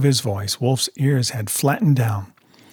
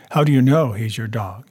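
plain reading voice

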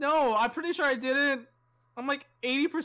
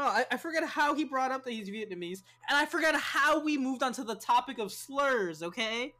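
usual speaking voice

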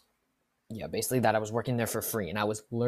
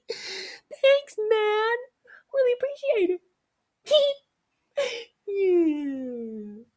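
very high, loud laughing scream; 10 dB louder, much slower than usual. Retold or imitated. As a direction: shriek the thanks like a maniac. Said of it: "I'd start screaming".

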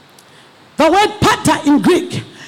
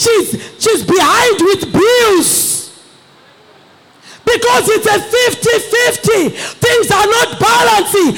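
fiery preaching; 3 semitones higher than usual. Acted. Preacher crying out to the men for fathers, full force. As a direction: preach it hard to the congregation.

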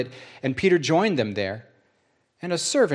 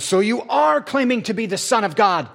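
shouted; 8 semitones higher, 4 dB louder than usual. Acted. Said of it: "And they all shouted".

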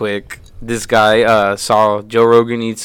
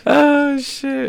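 high laughing exclamation. Real, not acted. Laughing reaction.

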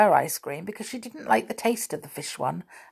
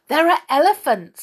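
excited; 9 semitones higher, 7 dB louder than usual. A narrator's reading. Moonface announcing treats.

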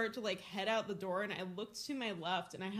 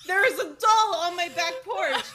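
much louder, higher, spelling it out in disbelief; exasperated yell; 8 semitones higher, 14 dB louder than usual. Retold or imitated. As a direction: shout it again, laughing with panic. Repeating to disbelieving mom.

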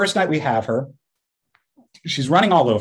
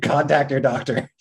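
mock ad read. Mock drug ad.

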